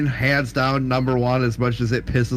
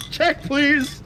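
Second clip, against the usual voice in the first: mock customer. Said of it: "quoting the movie line".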